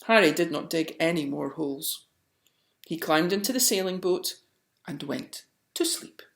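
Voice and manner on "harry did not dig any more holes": usual speaking voice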